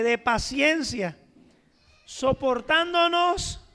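slow, emphatic preaching of virtues. Acted. Pastor listing virtues to the church, slow and emphatic. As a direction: draw out each virtue with weight.

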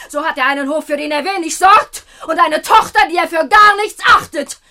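loud, high, indignant scolding; furious outrage. Acted. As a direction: drive him off, calling for help. Imogen empört ruft nach Diener. (empörtes, lautes Schelten)

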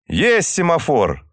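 cheerful, slow sailor reply. A narrator's reading. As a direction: answer gladly, drawn out. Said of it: "весело ответил сигнальщик".

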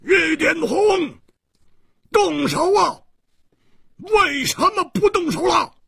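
very high, urgent yelling; loud shout. A narrator's reading. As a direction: yell at the swordsman to strike. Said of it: "突然有人大喝道". (高声大喝催促)

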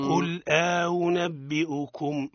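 slow قل أنبئكم with the hamza eased; model recitation. Shown as a demonstration. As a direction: recite قل أنبئكم slowly for the student to copy. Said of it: "أعدها". (تلاوة نموذجية بطيئة)

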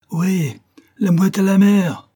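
high, loud shouted order; shouting. A narrator's reading. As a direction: bellow the order to launch. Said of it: "cria-t-il". (crier un ordre)

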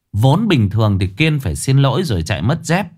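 usual speaking voice

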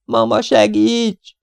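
high, stretched-out cry for help; desperate high-pitched plea; calling for mother. A narrator's reading. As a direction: wail slowly to mama in terror. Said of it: "valaki az édesanyját hívja".

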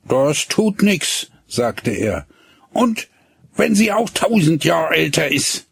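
lightly voiced boy, dogged; insist, not budging; stubborn boy. A narrator's reading. Hinnerk stubbornly defends his sweetheart.

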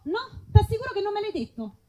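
ordinary talk, nothing marked

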